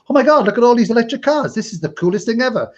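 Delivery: high-pitched excited marvelling; naive amazement. Retold or imitated. Retells naive first-winter amazement.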